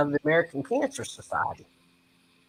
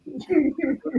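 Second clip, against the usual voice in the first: high burst of laughter; 11 semitones higher, 4 dB quieter than usual. Real, not acted. Laugh after the punchline.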